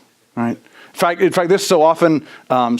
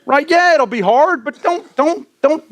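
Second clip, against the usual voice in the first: normal talking voice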